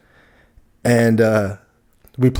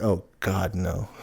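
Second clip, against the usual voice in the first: mortified groan; 4 semitones lower, 9 dB quieter, slower than usual. Retold or imitated. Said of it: "immediately I was like".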